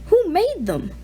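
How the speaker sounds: put-on boy asking in awe; nervous teen. A narrator's reading. Peter's teen voice.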